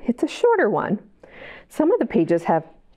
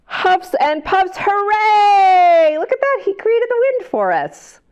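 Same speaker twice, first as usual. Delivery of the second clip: much higher, loud delighted hooray; excited cheer; 10 semitones higher, 4 dB louder than usual. Acted. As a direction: burst out in joy for the kids. Wolf makes wind, reader celebrates.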